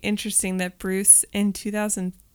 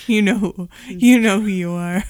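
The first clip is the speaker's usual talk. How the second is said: loud playful accusation; mock call-out; 9 dB louder than usual. Teasing whoever still has a Yahoo account, loud mock call-out. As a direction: call out the culprit loudly, in jest.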